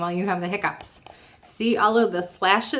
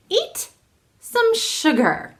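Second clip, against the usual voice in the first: high, slow playful ghost; 12 semitones higher, much slower than usual. Acted. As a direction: coax it out in a drawn ghost voice. Ghost's advice, voiced.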